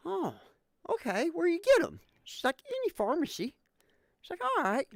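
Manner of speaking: put-on high old lady voice. Camel-smoking old lady asking about condoms, high voice. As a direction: squeak it like a sweet old lady.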